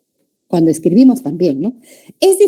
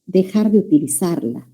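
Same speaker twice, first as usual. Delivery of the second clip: normal talking voice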